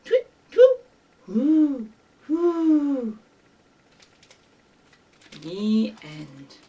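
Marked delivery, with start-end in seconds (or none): 0.0-0.8 loud, very high short tweets; bird tweeting. Acted. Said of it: "Now say good night". 1.3-3.2 long falling owl hoots; owl hooting. Acted. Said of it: "Now say good night".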